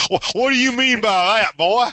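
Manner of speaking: high-pitched mock-offended challenge; mock indignation. Jokey challenge after accent teasing.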